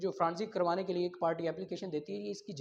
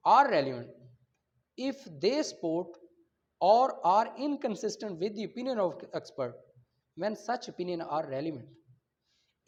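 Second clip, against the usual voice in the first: phrase by phrase reading; 4 dB louder, much slower than usual. Shown as a demonstration. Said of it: "कैसे?".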